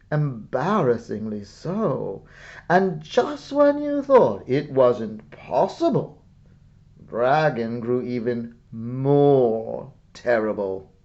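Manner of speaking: ordinary delivery, nothing marked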